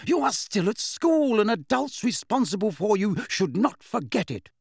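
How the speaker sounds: high, stern telling-off; scolding. A narrator's reading. Molly scolds Harry in quarrel.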